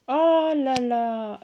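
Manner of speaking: loud, slow, drawn-out marvelling; real awe. Real, not acted. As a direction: stretch it out in amazement. Émerveillée par la beauté des cartes. (admiration étirée et sonore)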